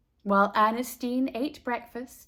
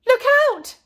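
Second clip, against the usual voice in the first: loud high shout of warning; 13 semitones higher, 9 dB louder than usual. A narrator's reading. Boy next door warns her of the flying ball, high loud shout. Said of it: "Hugo shouted".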